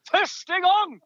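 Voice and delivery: high, drawn-out exclamation; exclaiming. Real, not acted. Commentator marvels at striker's first long shot, exclaiming. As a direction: pitch soaring in emphatic disbelief. Incredulous emphasis on a rare event.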